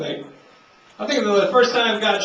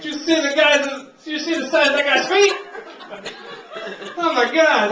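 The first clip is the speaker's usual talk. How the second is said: amazed yokel; 8 semitones higher than usual. Acted. Amazed woodsmen in a bit.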